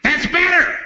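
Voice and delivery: high-pitched crazed approval; mock menacing lunatic; satisfied hostage-taker. Retold man commanding hushed passengers.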